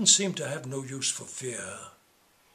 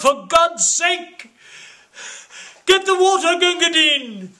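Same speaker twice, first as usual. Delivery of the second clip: high, loud frantic yelling; urgent battlefield plea for water; desperate cry; 13 semitones higher, 13 dB louder than usual. Acted. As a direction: scream for help in panic. Wounded soldier's desperate call.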